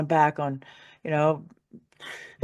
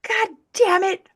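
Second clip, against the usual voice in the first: much higher and louder, demanding answers; angry outburst; 9 semitones higher, 3 dB louder than usual. Retold or imitated. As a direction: rage at the unseen voice. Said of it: "And then I got mad".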